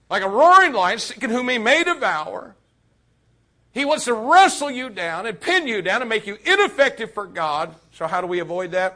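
fiery sermon shout; preaching at full force. Acted. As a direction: thunder it out to the young people.

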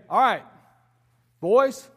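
put-on loud call to the boys; father summoning his sons to work, loud call.

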